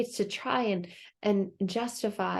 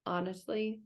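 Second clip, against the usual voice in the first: calming animals; 4 semitones lower, 7 dB quieter than usual. Retold or imitated. Other animals soothing panicked Chicken Little, soft calm reassurance. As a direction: talk her down gently. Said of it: "looking at Chicken Little like".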